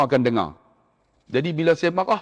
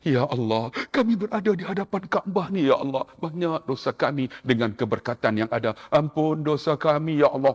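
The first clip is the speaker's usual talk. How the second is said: emotional prayer; 5 dB quieter than usual. Acted. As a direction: pray quietly to stir feelings. Ustaz leads emotional prayer.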